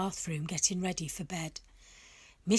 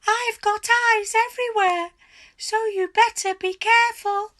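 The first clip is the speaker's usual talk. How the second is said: put-on high, loud gloating old hag voice; much higher and louder, gleeful cackling wife; 13 semitones higher, 9 dB louder than usual. A narrator's reading. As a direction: crow it at him like a triumphant witch. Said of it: "cackled Missus Twit".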